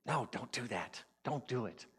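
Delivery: softly warning inner voice; a hushed, firm warning to oneself; cautioning ego. Acted. Ego warning the speaker off, cautioning. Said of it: "the ego side, side of me is going".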